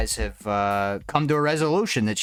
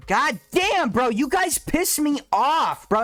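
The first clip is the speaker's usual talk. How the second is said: exasperated outburst; 14 semitones higher, slower than usual. Real, not acted. Streamer losing patience with his chat, exasperated high outburst. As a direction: blow up at the commenters, drawn out.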